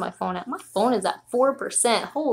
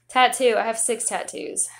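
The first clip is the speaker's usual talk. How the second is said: ordinary delivery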